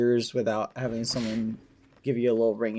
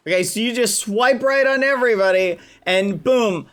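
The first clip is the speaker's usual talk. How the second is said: indignant; 8 semitones higher, 7 dB louder than usual. Acted. Indignant mock accusation.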